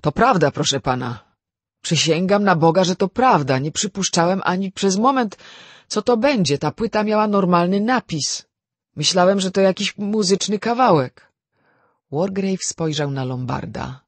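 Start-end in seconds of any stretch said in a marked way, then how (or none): 0.0-11.3 high, loud protest of innocence; agitated servant pleading to be believed; raised voice. A narrator's reading. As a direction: swear to it, voice raised and insistent. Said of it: "zwrócił się do niego podniesionym głosem".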